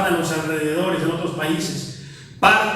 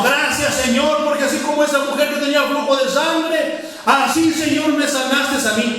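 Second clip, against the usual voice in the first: fervent prayer; 7 semitones higher than usual. Retold or imitated. A healed believer thanking God in tongues, fervent and high. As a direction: testify in prayer with full fervour. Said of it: "pero en otro idioma".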